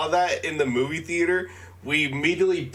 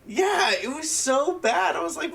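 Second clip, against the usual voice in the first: usual speaking voice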